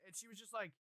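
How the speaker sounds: ordinary talk, nothing marked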